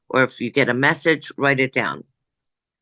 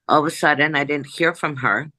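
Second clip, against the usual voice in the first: ordinary talk, nothing marked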